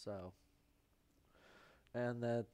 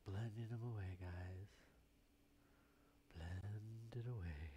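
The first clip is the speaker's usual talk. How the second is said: soothing murmur; 4 semitones lower, 10 dB quieter than usual. Pretend. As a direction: drop low and quiet, drawing the words out. Soothing painter murmur while blending.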